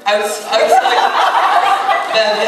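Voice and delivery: high, loud breathy laugh through the word; laughing restart. Real, not acted. Panelist cracking up after a joke, laughing. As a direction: try to restart while cracking up.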